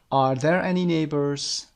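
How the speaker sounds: slow and clear, falling-rising question; slow model question. Shown as a demonstration. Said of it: "are رو در ابتدا میارید". (سؤال آهسته و شمرده)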